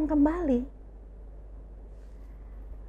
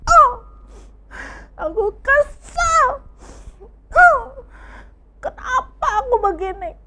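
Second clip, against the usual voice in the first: high, loud hammed-up whining sob; overacted crying vlogger; mock crying; 12 semitones higher, 10 dB louder than usual. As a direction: wail like an embarrassed vlogger. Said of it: "Dia ingin".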